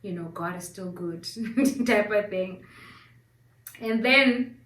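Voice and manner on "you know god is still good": gently soothe, much softer; imagined comforting husband. Retold or imitated. Husband ready to console his wife, soft comforting. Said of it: "ready to comfort me".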